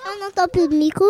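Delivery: usual speaking voice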